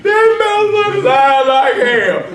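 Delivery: slow, sing-song, laughing outburst. Real, not acted. Speaker mocks the Mexican guide, laughing and singing. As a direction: stretch it out through laughter.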